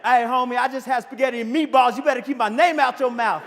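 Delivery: high, loud, hammed-up threat; mock confrontation. Speaker parodying a fight over dinner, mock tough shout. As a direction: square up like a street challenge.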